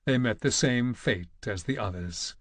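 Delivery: plain reading voice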